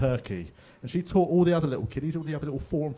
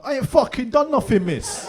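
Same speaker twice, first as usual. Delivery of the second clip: defiant schoolkid; 11 semitones higher, 5 dB louder than usual. Retold or imitated. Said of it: "I was almost like".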